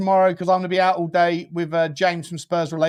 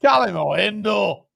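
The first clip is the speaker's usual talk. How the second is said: put-on Scottish accent; 4 semitones higher, slower than usual. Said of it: "Scottish accent".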